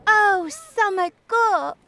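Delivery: high, loud, drawn-out delighted cry; rejoicing children. A narrator's reading. Children spot the summer gawk, crying out rejoicingly. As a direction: call it out with a child's glee. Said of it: "cried some children rejoicingly".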